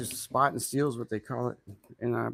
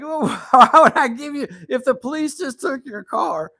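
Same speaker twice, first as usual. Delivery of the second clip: much higher and louder, laughing through it; laughing disbelief; 10 semitones higher, 11 dB louder than usual. Retold or imitated. Answering the scammer who wants a car, laughing in loud high disbelief. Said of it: "I'm like".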